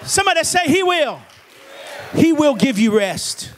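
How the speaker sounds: emphatic, driving preacher delivery; full-force preaching. Real, not acted. Preacher telling the congregation he loved you, preaching at full force.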